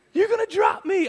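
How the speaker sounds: very high, loud mock-terrified kid; imitated panicked scream. Seven-year-old stuck in a tree to dad, mock panicked shriek. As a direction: shriek it as a hammed-up frightened child. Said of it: "I'm screaming".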